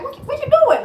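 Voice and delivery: very high, loud, laughing; laughing disbelief. Retold or imitated. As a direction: squeal in amazed disbelief. Retells disbelief at daughter.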